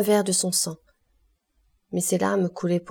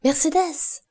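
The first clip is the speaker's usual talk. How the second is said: high, happy calling of a name; joyful call; 9 semitones higher, slower than usual. A narrator's reading. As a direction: call her gladly from outside the house. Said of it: "cria une voix joyeuse au dehors de la maison". (appel joyeux lancé d'une voix aiguë)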